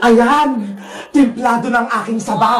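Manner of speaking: low, pleased villain voice; scheming wicked vendor. Acted. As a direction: relish your evil cooking. Villain readies her pot. (mababang boses ng masamang tindera, tuwang-tuwa)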